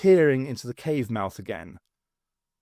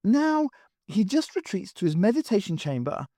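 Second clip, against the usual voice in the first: put-on high, rueful girl's voice; wistful, hurt, remembering; sad young woman; 7 semitones higher than usual. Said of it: "Rhea grimaced".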